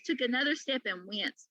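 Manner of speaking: ordinary delivery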